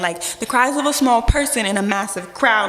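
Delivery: ordinary talk, nothing marked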